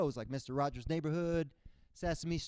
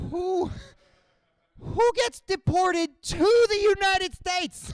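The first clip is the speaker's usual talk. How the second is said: high, loud, slow incredulous cry; hammed-up shocked friend; mock outrage; 11 dB louder, slower than usual. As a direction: squeal it in disbelief.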